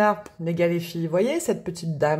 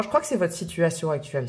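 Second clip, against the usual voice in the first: normal talking voice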